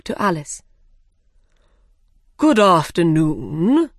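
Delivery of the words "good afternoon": slow, loud bird voice; dodo voice. A narrator's reading. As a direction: boom it out as a big slow bird. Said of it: "it said loudly".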